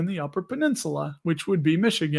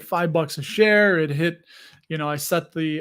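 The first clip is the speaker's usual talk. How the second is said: ordinary talk, nothing marked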